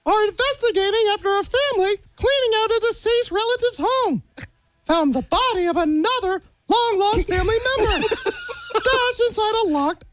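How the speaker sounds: cartoon mouse reading grim news; Mickey Mouse. Guest reads a grim Alaska headline to hosts, as a cartoon mouse. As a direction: read it out as a cartoon mouse newsreader. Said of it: "So Mickey Mouse headline news".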